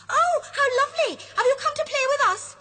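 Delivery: put-on high, loud, fast excited children; a crowd of children squealing with joy. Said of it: "they cry".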